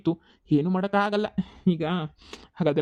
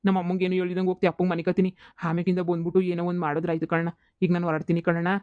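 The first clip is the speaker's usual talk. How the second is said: ordinary talk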